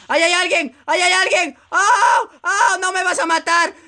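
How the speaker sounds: high, urgent alarm cries; alarma agitada. Real, not acted. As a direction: yelp as the enemy comes at you. Enemy sighted, under attack. (gritos agudos de alarma)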